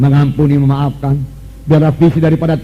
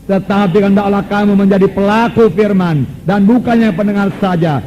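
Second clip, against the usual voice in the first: rising higher and louder to a slow climax; building proclamation; 4 semitones higher, 4 dB louder, slower than usual. Acted. Preacher warning the congregation, building proclamation. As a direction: drive the warning home at the end.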